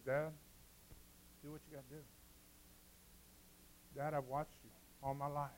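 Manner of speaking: soft, low-voiced boy; quiet trusting son. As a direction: speak gently to your father. Isaac speaks to his father.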